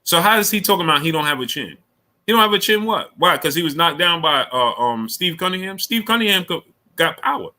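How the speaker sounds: rising indignation, pitch way up; heated disbelief. Real, not acted. Arguing with a viewer over Fury's chin, high heated rant.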